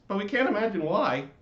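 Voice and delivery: high, loud, indignant chorus voice; fussy neighborhood lights; annoyed lights. Acted. The lights scolding the screaming latke, high and indignant. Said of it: "said the flashing colored lights".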